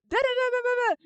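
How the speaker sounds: high rattled-off angry babble; wordless ranting gibberish. Acted. Kira's lecture heard from outside, high mock ranting babble. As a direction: imitate indistinct yelling in high syllables. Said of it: "you just hear screaming".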